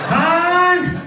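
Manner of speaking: high, loud bark of a name; shrill nagging yell. Acted. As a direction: yell across the house at a spouse. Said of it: "That's my sexy voice now".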